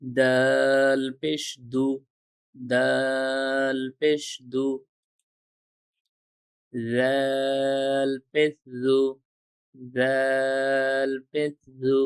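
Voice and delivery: very slow, each syllable drawn out like a chant; slow recitation drill. Shown as a demonstration. Teacher reciting Arabic letters slowly for students to repeat. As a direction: stretch every letter name slowly for the learners.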